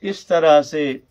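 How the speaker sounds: usual speaking voice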